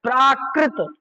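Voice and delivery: "प्राकृत" drawn out as praa-krit; long "praa", then "krit" with no "ik" in "प्राकृत"; careful correct pronunciation. Shown as a demonstration. Teacher correcting the class, "प्राकृत" said carefully ending on t. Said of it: "प्राकृतिक नहीं".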